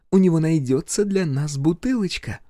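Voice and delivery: sly teasing female voice; Viv's voice. Acted. Viv's voice continues.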